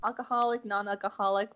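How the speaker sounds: normal talking voice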